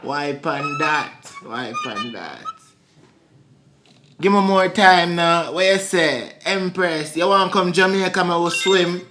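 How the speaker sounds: playful put-on Jamaican patois; Jamaican accent. Inviting her to swim in Jamaica, in a Jamaican accent. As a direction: keep up the Jamaican act. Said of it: "You just did that accent".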